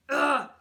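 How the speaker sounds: loud disgusted grunt; frustrated ugh. Acted. Boy told the shoes sold out, loud disgusted ugh. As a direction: let out an exasperated ugh.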